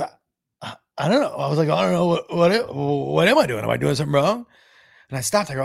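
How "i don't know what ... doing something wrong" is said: mildly flustered, stammering worry; a touch of mock panic; retold panicking. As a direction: retell a rattled, unsure young man. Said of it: "I stopped 'cause I was panicking".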